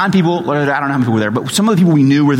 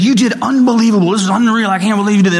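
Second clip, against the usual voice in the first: gushing [name]; 6 semitones higher, much faster than usual. Retold or imitated. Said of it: "and he's like".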